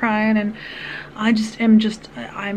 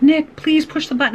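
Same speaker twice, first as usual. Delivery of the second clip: high, urgent plea through tears retold; begging; 6 semitones higher, 4 dB louder than usual. Mother pleading with toddler locked in car, begging. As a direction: re-enact a desperate parent's plea. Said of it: "I'm, like, begging him".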